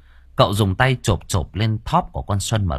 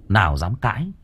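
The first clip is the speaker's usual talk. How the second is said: ordinary delivery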